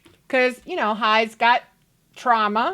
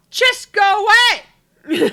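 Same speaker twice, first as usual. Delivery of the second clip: high, loud dismissal; mock angry yell; 10 semitones higher, 8 dB louder than usual. Telling paparazzi to leave, mock angry yell. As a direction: loudly shoo them off in pretend annoyance.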